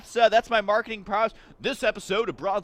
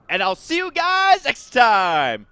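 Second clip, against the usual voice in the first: slow, louder sign-off; outro voice; 4 semitones higher, 6 dB louder, slower than usual. Acted. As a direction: drag out the goodbye. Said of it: "Nailed it".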